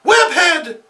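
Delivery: loud, high furious yell; angry cry. Acted. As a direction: scream his name in rage. Felicity spots Spider-Man.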